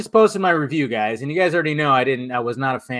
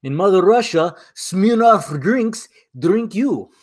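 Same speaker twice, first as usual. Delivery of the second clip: put-on higher, drawn-out comic Russian accent; mock Russian accent; 5 semitones higher, slower than usual. Vodka streamer joking about Smirnoff in a mock Russian accent. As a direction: put on a joke Russian accent, slowing for the punchline.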